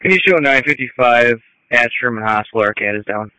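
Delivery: crackly handheld radio transmission; ambulance radio. Real, not acted. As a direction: voice over a distant radio. Crew calling in over radio.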